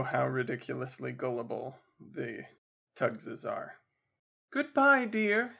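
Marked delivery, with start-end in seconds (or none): 4.5-5.5 high, sing-out ladylike farewell; Missus Captain Waters. Acted. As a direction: call goodbye in a high lady's voice. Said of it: "said Missus Captain Waters".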